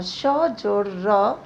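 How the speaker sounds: श जोड़ र said very slowly; slow spelling out. Shown as a demonstration. Teacher slowly building श्र for the class. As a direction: drag out श जोड़ र piece by piece. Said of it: "छ को देखिए".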